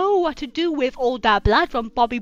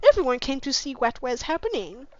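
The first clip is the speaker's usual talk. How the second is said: ordinary talk, nothing marked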